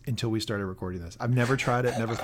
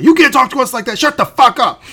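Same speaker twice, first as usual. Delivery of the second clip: yelled much higher and louder, furious; Boris shouting; 14 semitones higher, 16 dB louder than usual. Retold or imitated. Boris screaming at the miners to shut up. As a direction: explode at them like an enraged official. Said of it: "I did my Boris voice".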